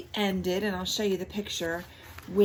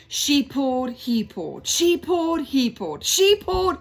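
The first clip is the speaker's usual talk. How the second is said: building strain; 6 semitones higher, 5 dB louder than usual. A narrator's reading. Tug of war getting harder.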